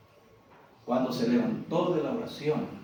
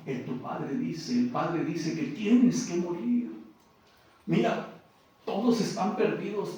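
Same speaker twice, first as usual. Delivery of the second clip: angel and Jesus dialogue; 8 semitones higher than usual. Acted. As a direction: tell him he must die, then resist. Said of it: "las palabras del ángel".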